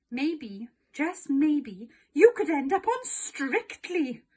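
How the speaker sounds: excited. A narrator's reading. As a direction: Mum dreaming big, voice climbing with excitement. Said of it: "added Mum excitedly".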